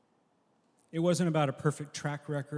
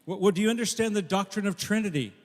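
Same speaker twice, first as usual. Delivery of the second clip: high, loud, sputtering angel; exasperated angel; 7 semitones higher, 8 dB louder than usual. Acted. Angel grilling the thief on doctrine, high and loud. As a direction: angel at wits' end, firing the question.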